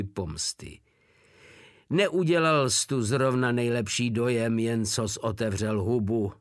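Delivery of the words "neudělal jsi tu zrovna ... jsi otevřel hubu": ordinary delivery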